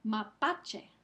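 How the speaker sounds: "Mapache" with PA stronger; stress on PA. Shown as a demonstration. Teacher presenting a word to students, stress on PA in "Mapache". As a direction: hit the middle syllable of "Mapache". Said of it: "Pa es la sílaba que recibe".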